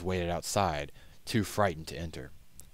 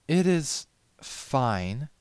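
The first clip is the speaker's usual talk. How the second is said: lightly voiced high lady, slow and measured; drawn-out haughty verdict; Rarity; 9 semitones higher, 5 dB louder, much slower than usual. A narrator's reading.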